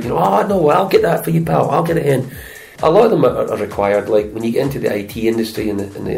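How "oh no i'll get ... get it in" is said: higher-pitched eager offer of help; helpful Scot. Acted. Scot offering a pal a favour, higher-pitched and eager. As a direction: jump in to help, voice lifted. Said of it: "notorious for helping people out".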